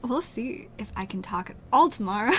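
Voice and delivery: ordinary talk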